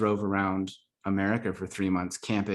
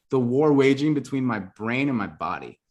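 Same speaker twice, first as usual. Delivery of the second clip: normal talking voice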